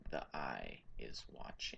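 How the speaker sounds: barely voiced, low and eerie; eerie whisper. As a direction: breathe the warning almost silently. NPC repeats it softer.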